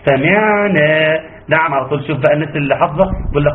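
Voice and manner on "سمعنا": slow سمعنا, lingering moderately on ع; measured ayn. Shown as a demonstration. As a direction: sustain the unvowelled ع in سمعنا. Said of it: "إن فيها توسط". (سمعنا بتوسط العين)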